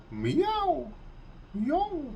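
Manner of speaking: high squeaky cat mew; cat meow impression. Shown as a demonstration. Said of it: "for the Russians it's".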